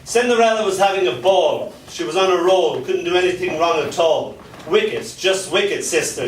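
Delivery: rhythmic spoken-word delivery; performance poem. Acted. Poet reciting dark fairy tale to a crowd.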